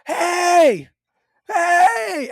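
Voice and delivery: full-throated shout to rouse an animal; yelling at the bull. Retold or imitated. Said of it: "and I'm yelling".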